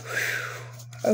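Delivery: a big nervous whew; letting out a breath before the reveal; nervous unvoiced exhale. Real, not acted. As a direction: blow out a shaky breath of nerves.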